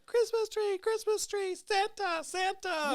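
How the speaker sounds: put-on very high, squealing little-kid voice; imitated overexcited toddler. Dad imitating his daughter seeing the tree, high excited squeal. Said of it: "lost her shit".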